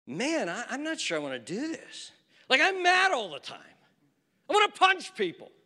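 mock the sulky teenage boy; teen son voiced. Teen son complains he is angry.

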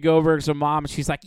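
ordinary talk

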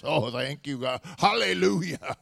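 loud, high mock praise with a laugh; churchy praise. Preacher parodying praise, loud and high. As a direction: keep hamming the holy voice.

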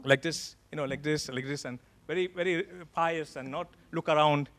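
very soft, subdued demonstration; hushed piety. Shown as a demonstration. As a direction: drop right down, as if keeping reverent in church. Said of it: "we had to be very".

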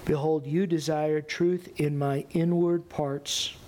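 low, subdued psalm of repentance; sober, even-toned prayer of confession; solemn scripture reading. Acted. Reads David's psalm of repentance.

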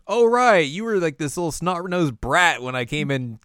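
high, loud sudden realisation; mock recognition. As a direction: blurt it out high as it dawns on her. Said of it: "she's like".

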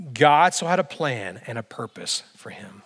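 whispered, intimate reveal; a hushed sermon aside; emphatic whisper. Real, not acted. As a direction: lean in and whisper it. Preacher drops to emphatic whisper.

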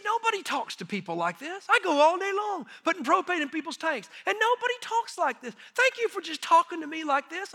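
high-pitched tearful retelling; imitated tearful man. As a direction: voice climbing as he imitates a man crying. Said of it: "he started crying".

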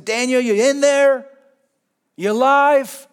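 high, loud, urgent call into the den; anxious loud call, high and pleading. Retold or imitated. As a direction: call down anxiously to a friend you fear is dead. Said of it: "He doesn't just say something".

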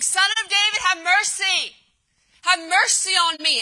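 high, insistent cry for mercy. Acted. Blind beggar refuses to be silenced by crowd, crying out. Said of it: "Bartimaeus cry out all the more".

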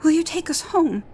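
put-on high, fast, loud little girl's plea; a child begging to go home; high eager girl; hopeful girl. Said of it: "asked Natsu".

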